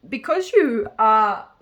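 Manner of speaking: words pushed out through a burp; talking through a coffee burp. Real, not acted. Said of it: "I'm burping from my coffee".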